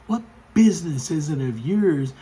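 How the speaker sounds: mock-affronted challenge; indignant mother. Offended mother rebuking a stranger over her kids, mock indignant.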